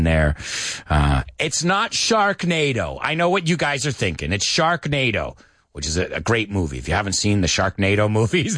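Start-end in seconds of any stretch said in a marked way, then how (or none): none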